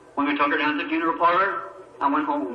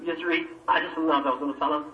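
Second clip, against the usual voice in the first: usual speaking voice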